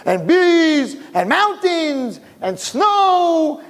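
high, drawn-out marvelling; excited telling. Acted. Excited unborn twin listing wonders to his brother, high and eager. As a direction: stretch each wonder up high. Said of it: "the other one's telling him".

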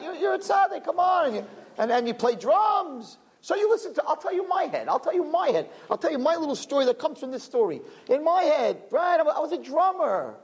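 butter yourself up in a high, teasing tone; the other voice. Retold or imitated. His own inner voice flattering the young drummer, high and teasing. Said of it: "the other voice said".

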